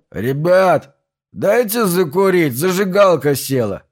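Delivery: put-on drunken neighbour calling out loudly; slurred drunk begging for a light; town drunk. A narrator's reading. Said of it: "Раздался пьяный голос соседа". (пьяный голос соседа)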